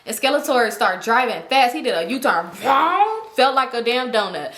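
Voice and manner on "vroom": rising engine noise imitation; vocal vroom sweeping upward; car vroom. Imitates the speeding U-turn.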